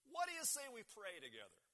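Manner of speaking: high, loud call to a crowd. A narrator's reading. Tony up on a table addressing the diner, calling out. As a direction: call the room to prayer.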